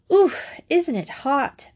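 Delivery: a light touch of breathless panting. A narrator's reading. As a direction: pant it out, worn out by the climb. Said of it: "panted Margia".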